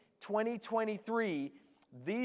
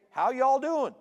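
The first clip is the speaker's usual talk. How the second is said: louder, higher Southern y'all greeting; hammed-up Southern US hello; 5 semitones higher, 7 dB louder than usual. Shown as a demonstration. Newcomer greeting people the Southern way, Southern accent. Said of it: "we had to say".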